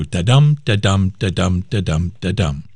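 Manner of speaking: "da-dum" chanted five times, each dum stressed; iambic beat. Shown as a demonstration. Host counting out the pentameter line, rhythmic "da-dum" stress. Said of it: "five iambic feet in a row".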